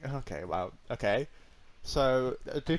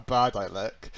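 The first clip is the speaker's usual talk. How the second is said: normal talking voice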